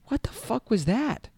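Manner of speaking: high, incredulous what the fuck; baffled exclamation. Retold or imitated. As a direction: squeak it out in disbelief. Baffled reaction after awkward exchange.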